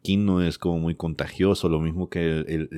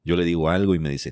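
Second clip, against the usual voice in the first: ordinary delivery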